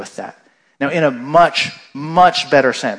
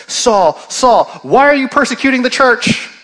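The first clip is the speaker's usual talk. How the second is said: Saul, Saul, called out high and loud; a heavenly voice crying out from the light; booming divine voice; 9 semitones higher, 5 dB louder than usual. Retold or imitated. The voice from heaven calls out to Saul, booming. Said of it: "his voice cries out and says".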